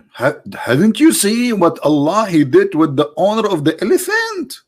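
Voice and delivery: high, drawn-out mock recital; theatrical verse reading. Comedian reading the elephant verse aloud, theatrical recital. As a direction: read it out up high, stretching the words.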